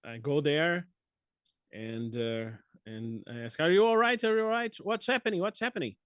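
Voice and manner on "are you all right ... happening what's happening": high, loud, urgent questioning; urgent concern. Acted. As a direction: call out in alarm to someone in trouble. Player acts out rushing to help.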